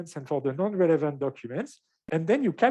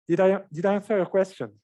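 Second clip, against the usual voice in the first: slightly winded, catching breath; 2 semitones higher than usual. Real, not acted. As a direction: talk while still short of breath. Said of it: "catching my breath a bit".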